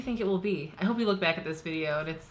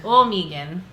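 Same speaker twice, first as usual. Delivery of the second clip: drawn-out Midwest Oh and Megan; Midwest accent; 7 dB louder, slower than usual. Shown as a demonstration. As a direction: lean into the Midwest vowels in Megan. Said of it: "that's how I gotta say it".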